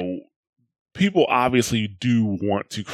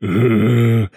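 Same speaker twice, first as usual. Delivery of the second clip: zombie groan; 7 dB louder than usual. Retold or imitated. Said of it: "instead of hearing".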